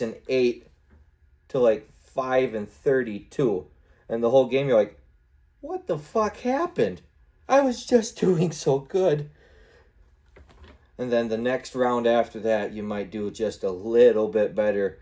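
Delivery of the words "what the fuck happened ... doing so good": very high, slow whine; hammed-up falsetto complaint of a losing gamer; mock dismay. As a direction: wail in disbelief, drawn out and high. Retold dismay at losing badly.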